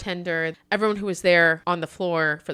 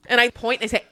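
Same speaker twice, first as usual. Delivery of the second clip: ordinary talk, nothing marked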